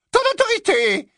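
very high, scornful echo; contemptuous. Acted. Bellatrix retorts to Lucius's claim, contemptuous. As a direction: throw the word back with scorn. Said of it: "répliqua-t-elle avec un mépris".

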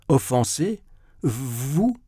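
haughty astonishment; scornful disbelief. A narrator's reading. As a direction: echo it as if the idea were absurd. Zverkov scornfully echoes the apology.

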